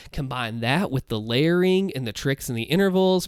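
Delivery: ordinary delivery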